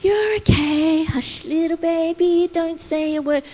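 sing-song soothing, mocked; mock lullaby. A soothing God the preacher rejects, mock lullaby. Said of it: "God's not just in here going".

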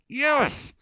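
high-pitched triumphant "yes"; pumped. Retold or imitated. Pastor after visitors enjoyed church, pumped cheer. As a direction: celebrate in a high, squeezed cheer. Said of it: "I was so pumped".

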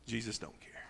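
hushed, unvoiced whisper. Preacher whispering his answer to the proud pew-sitter. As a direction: breathe it like a secret.

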